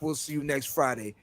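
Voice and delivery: ordinary talk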